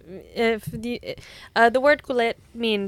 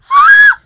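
loud high gasp of excitement; excited gasp; 18 dB louder than usual. Real, not acted. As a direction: gasp in thrilled shock.

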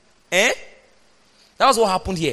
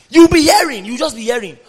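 emphatic shout; 3 semitones higher, 7 dB louder than usual. Real, not acted. Preacher building to shout.

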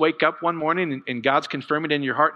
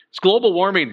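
announcing global warming, louder and higher; mock alarm; 5 semitones higher, 5 dB louder than usual. Shown as a demonstration. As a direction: say it like a scary headline. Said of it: "We used to call it July".

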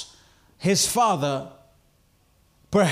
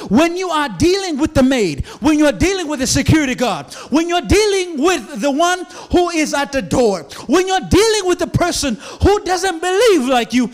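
higher and louder, driving each "when" home; preaching at full force; 7 semitones higher, 6 dB louder than usual. Real, not acted. Pastor pressing the congregation about their daily witness, shouted preaching. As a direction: build the list like a revival preacher, ever more urgent.

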